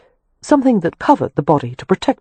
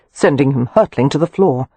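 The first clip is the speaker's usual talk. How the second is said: ordinary delivery, nothing marked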